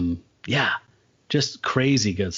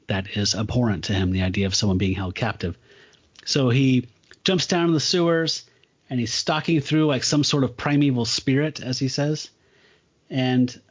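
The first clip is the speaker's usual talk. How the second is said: plain reading voice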